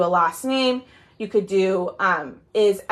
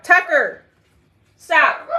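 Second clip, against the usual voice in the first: firm scolding mom voice, higher and louder; 6 semitones higher, 6 dB louder, slower than usual. Real, not acted. Mom scolding her dog Tucker mid-stream in mom voice. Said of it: "I had to get my mom voice out".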